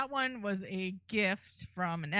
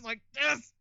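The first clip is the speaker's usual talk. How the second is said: strained imitation of a villain through clenched teeth; talking through teeth; 3 semitones higher than usual. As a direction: force it out through gritted teeth. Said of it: "always talking through his teeth".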